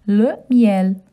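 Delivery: le miel as luh myel; French pronunciation. Shown as a demonstration. Teaching children le miel. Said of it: "Honey in French".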